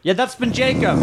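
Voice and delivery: high-pitched call back. Pretend. Trapped rider answering the rescuer above, high-pitched calling.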